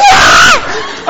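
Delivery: low, loud panicked yell; startled yell. Retold or imitated. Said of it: "and I go".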